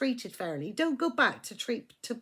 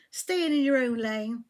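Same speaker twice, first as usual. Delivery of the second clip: ordinary talk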